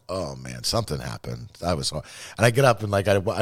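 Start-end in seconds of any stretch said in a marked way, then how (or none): 0.1-1.5 much softer, low sinking realization; quiet dread the morning after; waking up hungover and realizing something went wrong, in quiet dread. Retold or imitated. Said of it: "just that pit in my stomach".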